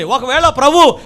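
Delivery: usual speaking voice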